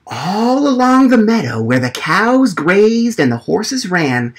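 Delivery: ordinary delivery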